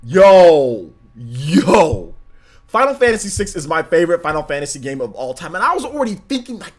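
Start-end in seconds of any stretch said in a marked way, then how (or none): 0.0-2.2 long drawn-out, amazed "yo"; awestruck exclamation. Acted. Fan floored by the spiritual successor news, awestruck. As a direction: let each word swell in disbelief. Reacting to exciting game news.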